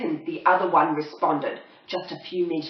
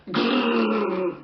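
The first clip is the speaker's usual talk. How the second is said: a higher dog growl; 6 semitones higher than usual. Acted. Poodles surprising dingoes, a mock growl. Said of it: "they growled loudly".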